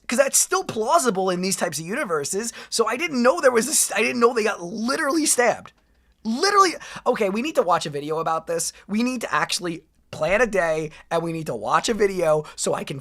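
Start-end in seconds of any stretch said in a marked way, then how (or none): 6.2-6.7 higher, stunned single word; shocked emphasis. Real, not acted. Stunned at the stabbing news, shocked emphasis. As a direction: land it in shock.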